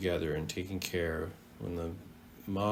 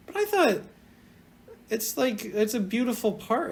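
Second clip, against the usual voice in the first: excited; 12 semitones higher than usual. Real, not acted. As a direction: jump in eagerly. Excited high exclamation.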